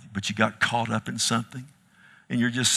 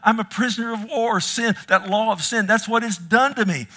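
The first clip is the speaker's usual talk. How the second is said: high, loud preacher's cry; preaching at full force; 8 semitones higher, 4 dB louder than usual. Acted. Preacher pleads with the church, loud and high. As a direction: preach it hard to the congregation, climbing.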